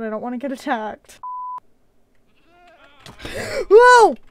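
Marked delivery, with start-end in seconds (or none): 3.0-3.6 sharp startled gasp. Real, not acted. Rammed by a goat. 3.7-4.2 loud startled whoa; jolted cry of surprise; startled yelp. Real, not acted. Rammed by a goat.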